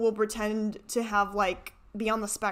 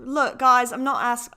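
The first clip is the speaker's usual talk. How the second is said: put-on a light try at the clip's British accent; hint of a British accent; imitating British accent; 5 dB louder than usual. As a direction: mimic his British way of talking. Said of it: "Well, how's he talk again?".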